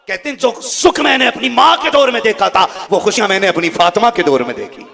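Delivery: loud declamation, high then settling; full-force preaching. Acted. Preacher quoting the Prophet about mother and daughter, loud declamation. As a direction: proclaim the quote with force.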